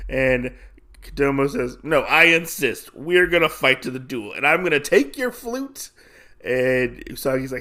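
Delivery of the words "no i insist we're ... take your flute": lightly acted challenger, pitch climbing; hint of a pushy duelist; retold insistent challenger. Challenger threatening to take the flute, lightly acted insistence.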